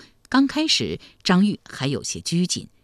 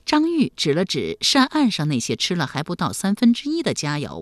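plain reading voice

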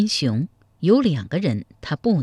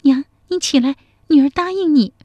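put-on high, tearful young woman's voice, a little louder; 10 semitones higher, 4 dB louder than usual. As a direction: cry out giving in. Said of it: "泪流满面地说".

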